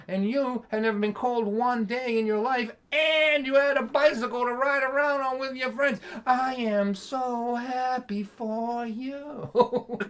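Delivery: high, drawn-out mock joy, slow on so happy; dripping sarcasm. Dad dripping sarcasm at his spoiled son. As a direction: smother him in fake happiness. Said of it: "dripping with sarcasm".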